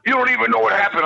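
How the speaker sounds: mock yell at the roommate who slept through it; yelling at friend. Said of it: "I'm yelling at Knobs".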